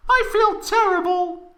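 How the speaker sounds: put-on very high, slow falsetto girl voice; giggly Tifa voice. Tifa giggling to Cloud in falsetto. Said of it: "giggled Tifa".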